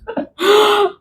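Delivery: a quick, high amused laugh; short high laugh. Real, not acted. Laughs at the demons.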